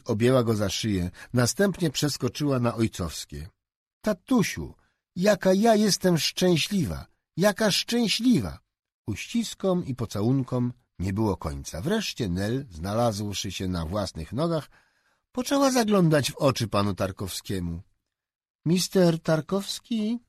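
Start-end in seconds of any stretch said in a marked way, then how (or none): 4.0-8.6 put-on high, bright child's cry of happiness; a young daughter thanking her father, overjoyed; overjoyed little girl. 18.6-20.2 put-on a high, slow, wheedling child's voice; a child coaxing an adult for a favour; coaxing little girl.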